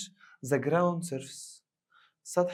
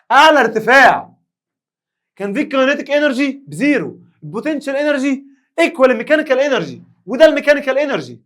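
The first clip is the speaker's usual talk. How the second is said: much louder and higher, fed-up yelling; mock angry shouting; 9 semitones higher, 17 dB louder than usual. Teacher explodes at a student who can't follow, loud mock rant. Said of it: "مستر اتعصبت ليه؟".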